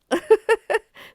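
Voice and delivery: a real burst of high laughter; bright laugh. Real, not acted. Spiritual teacher laughing at her own point, loud laugh.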